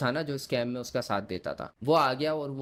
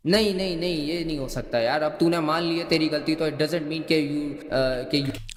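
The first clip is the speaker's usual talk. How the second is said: louder, mock protesting voice; insistent objection; 2 semitones higher, 5 dB louder than usual. Retelling the friend rejecting his admission, loudly insistent. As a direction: imitate someone refusing to let it go. Retold opponent refusing the admission.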